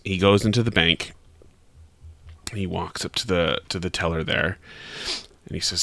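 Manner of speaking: usual speaking voice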